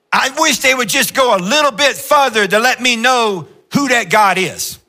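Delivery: high, urgent preacher plea; fervent high appeal; pleading. Acted.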